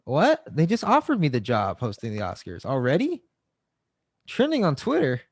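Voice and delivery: loud, high-pitched cries of disbelief; mock outrage. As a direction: play a canceled star's loud meltdown. Acts out being canceled on the spot.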